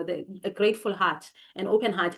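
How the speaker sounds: normal talking voice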